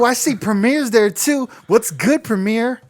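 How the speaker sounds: high, bubbly mock hype voice; cheerleader energy. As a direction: imitate an over-eager MC greeting a star. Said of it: "It was like that cheerleader energy".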